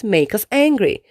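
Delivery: usual speaking voice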